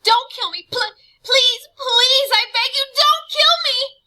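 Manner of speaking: high, loud terrified pleading, stammering; boy begging the monster to spare him; begging for mercy. Acted. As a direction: plead for your life on your knees. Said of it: "begging for mercy".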